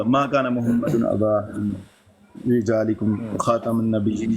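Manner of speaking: slow, lightly intoned verse quoted from him; Quranic recitation. Retold or imitated. Quoting the verse the debater read, slow light chant. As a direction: recite it slowly like the opponent did. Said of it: "یہ آیت پڑھی".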